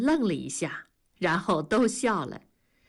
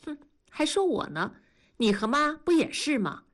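playful reproach; 7 semitones higher than usual. Acted. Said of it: "嗔怪地说".

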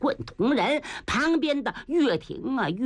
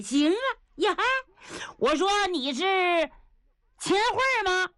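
gruff general surprised; 7 semitones higher than usual. Acted. Niu Gao spotting Qin Hui.

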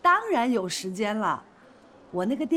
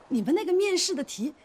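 usual speaking voice

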